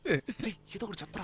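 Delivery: very soft conspiratorial aside; hushed aside. Acted. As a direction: mutter it so the clerk can't hear. Secret prompt to friend before clerk.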